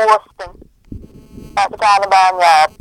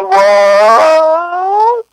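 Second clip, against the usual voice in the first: higher, long rising what; dazed, confused what; 7 semitones higher, 3 dB louder than usual. Retold or imitated. Imitating himself baffled by the missing storyline. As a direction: stretch a baffled what upward. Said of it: "I always sound like".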